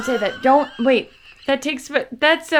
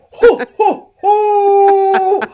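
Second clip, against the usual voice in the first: laughing; 9 semitones higher, 12 dB louder, much slower than usual. Real, not acted.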